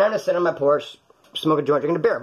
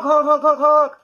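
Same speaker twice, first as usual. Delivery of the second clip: loud high honks like a truck horn; mock car horn, shouted high; honking horn imitation; 10 semitones higher, 6 dB louder, slower than usual. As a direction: blare each honk like a horn.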